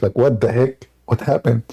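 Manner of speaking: usual speaking voice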